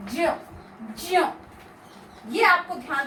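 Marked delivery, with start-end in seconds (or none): 0.1-1.3 ज repeated slowly and clearly; careful demo. Shown as a demonstration. Teacher repeats the sound for students to copy. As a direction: model the pure ज twice. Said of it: "इसका जो उच्चारण बनेगा आपका".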